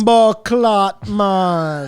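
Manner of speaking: put-on slow, loud Jamaican accent; drawn-out Jamaican slang. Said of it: "do a Jamaican accent real quick".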